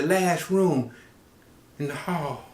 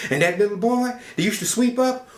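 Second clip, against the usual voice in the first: ordinary delivery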